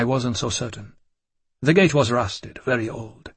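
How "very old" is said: usual speaking voice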